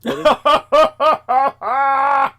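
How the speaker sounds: squealed out high and loud through laughter; words breaking up in a laughing fit. Real, not acted. Quoting curious people while laughing helplessly.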